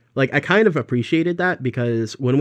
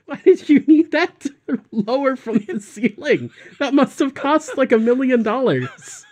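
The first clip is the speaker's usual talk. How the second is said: laughing disbelief; 12 semitones higher, 5 dB louder than usual. Real, not acted.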